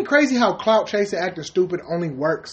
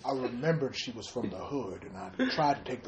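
low, very soft celebrity impression; mock confession, quietly in character; hushed, lower put-on comedian voice; Bill Cosby; 4 semitones lower, 10 dB quieter than usual.

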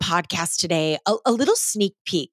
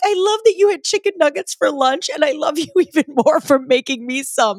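gushing delight; 7 semitones higher, 3 dB louder than usual. Retold or imitated. Thrilled husband made her nuggets.

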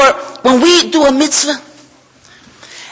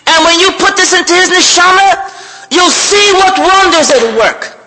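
loud, slow, high preaching voice; full-force preaching; 8 semitones higher, 5 dB louder than usual. Acted. Preacher giving Hashem's words to the crowd, full-force preaching.

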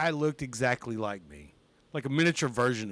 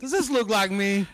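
laughing; 6 semitones higher, 5 dB louder than usual. Retold or imitated. Showing the page, laughing.

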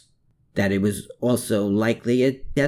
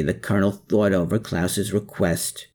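plain reading voice

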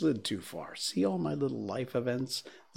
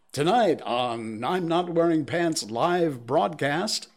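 announcer voice; 5 semitones higher, 4 dB louder, slower than usual. Pretend. Mock show intro announcement.

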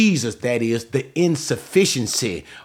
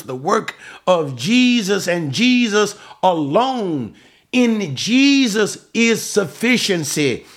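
raised, ringing sermon delivery on each phrase; full-force preaching; 6 semitones higher than usual. Real, not acted. Preacher insisting Jesus alone suffices, emphatic preaching. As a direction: preach it out, leaning hard on "Jesus".

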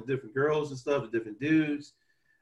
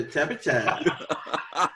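laughing; much slower than usual. Retold or imitated. Quoting Pootie Tang phrases.

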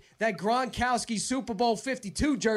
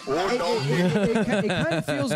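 talking through laughter, louder; laughing; 6 dB louder than usual. Real, not acted. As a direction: laugh while getting words out. Laughing after caller's joke.

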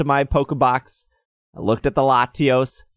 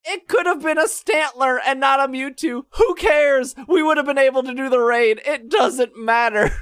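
much higher, far-off exasperated voice; fed up, pitched way up; exasperated rant; 14 semitones higher than usual. Real, not acted. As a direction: rant in disbelief at the pettiness. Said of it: "everything I hate about Pokemon Go".

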